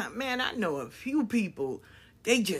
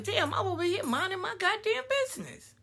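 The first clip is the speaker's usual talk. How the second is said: higher, fast, put-upon protest; exasperated innocent; 10 semitones higher, 3 dB quieter, faster than usual. Retold or imitated. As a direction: act wronged and baffled. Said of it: "And you're like".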